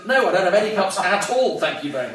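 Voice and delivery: loud, high indignant reply; hammed-up indignant comeback; defiant retort. Retold defiant reply to friend.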